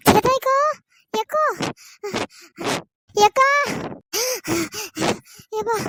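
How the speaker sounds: calling out while panting from running; breathless, just arrived running; out of breath. Acted. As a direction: gasp the words after a run. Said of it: "ஏன் இப்படி மூச்சு வாங்குற அளவுக்கு ஓடி வர?".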